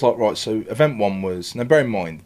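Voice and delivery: ordinary talk, nothing marked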